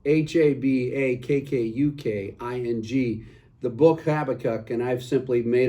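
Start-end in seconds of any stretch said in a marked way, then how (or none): none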